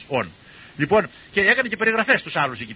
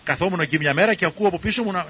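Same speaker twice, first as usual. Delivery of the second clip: usual speaking voice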